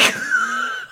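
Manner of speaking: squealing high laugh; shrieking laugh. Real, not acted. Laughing fit.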